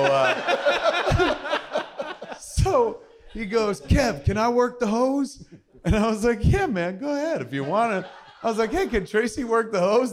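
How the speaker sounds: telling it while cracking up; giggling through the retold request; laughing. Real, not acted. Laughing through the hose story.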